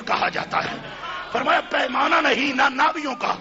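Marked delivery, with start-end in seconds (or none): 1.3-3.3 from steady to louder, higher preaching; building sermon. Acted. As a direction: climb toward a ringing peak.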